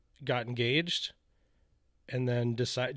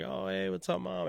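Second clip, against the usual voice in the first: fake casual greeting; 8 semitones higher than usual. Feigning casual to angry mom.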